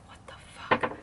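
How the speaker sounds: breathy hushed whisper, barely voiced; frightened under-the-breath whisper; scared whisper. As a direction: whisper it like you're afraid of being heard. Retelling a scared late-night reaction.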